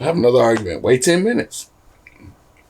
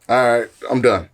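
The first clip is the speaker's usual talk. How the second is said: ordinary delivery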